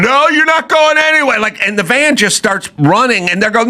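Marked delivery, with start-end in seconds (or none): 0.0-1.4 much higher mock protester voice; mock shrill protester. Mocking a protester blocking a police van, much higher. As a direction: squeal it like a defiant protester. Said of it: "women standing in front of police vans going".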